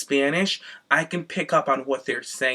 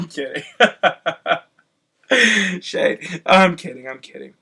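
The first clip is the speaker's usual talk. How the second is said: normal talking voice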